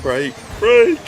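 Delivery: high, loud urgent shout of brake; nagging backseat driver. Real, not acted. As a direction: yell it like a panicked passenger. Urgent shout at braking point.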